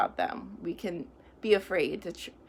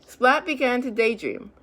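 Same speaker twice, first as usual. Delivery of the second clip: lively, louder storybook reading; reading to children; 3 semitones higher, 6 dB louder than usual. A narrator's reading. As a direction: read it out brightly to a class. Reads picture book to children.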